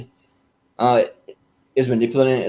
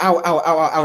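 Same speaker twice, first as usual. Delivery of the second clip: Ed Grimley burnt yelps; 5 semitones higher than usual. Retold or imitated. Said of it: "he's just like".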